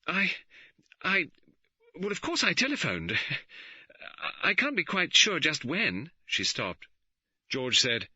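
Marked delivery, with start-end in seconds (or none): 0.1-6.1 high, hesitant stammering; falter, unsure of the story; uncertain. A narrator's reading. Magdalen faltering to the superintendent, high and hesitant. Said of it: "She said uncertainly".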